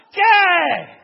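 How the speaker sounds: qué gritado, muy agudo y fuerte; grito furioso. Acted. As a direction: estalla de golpe, harto. Abraham estalla ante el niño. (Abraham le grita a su hijo, furioso)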